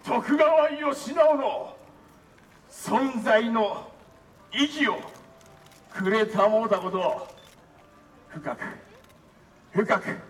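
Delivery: slow solemn speech building to a shout; formal declaration. Acted. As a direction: build from solemn to roaring thanks. Formal closing vow building to shout.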